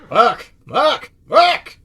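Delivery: three rising curse yells; frustrated yells. Real, not acted. Reacting to dying in game.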